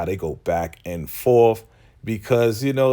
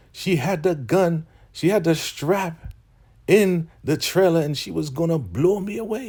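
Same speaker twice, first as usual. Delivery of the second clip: imitated accusation; 10 semitones higher than usual. Recapper voicing Elder Mother telling on the girl, high mock complaint. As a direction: act out her story in a put-on high voice.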